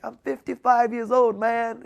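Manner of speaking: high, loud, strained with emotion; emotional outburst from a man overcome; retold tearful words. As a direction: imitate a grown man close to tears. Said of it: "and he was crying".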